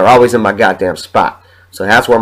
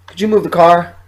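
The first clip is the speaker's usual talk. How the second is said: much higher, sing-song mock landlord; landlord on the phone; 8 semitones higher than usual. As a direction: mimic her sweet phone voice, pitched way up. Landlord voiced again.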